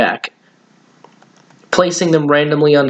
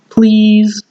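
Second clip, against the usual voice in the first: high, louder begging please; cheesy pleading; 9 semitones higher, 5 dB louder than usual. Acted. Boy begs mom for skateboard, cheesy high plea. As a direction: wheedle it with a cheesy grin. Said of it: "he added for good measure".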